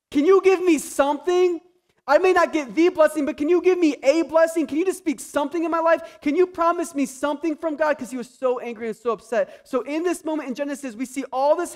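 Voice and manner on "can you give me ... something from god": much higher and louder, begging his father; desperate pleading. Retold or imitated. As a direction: cry out for any blessing at all. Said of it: "Esau was so mad".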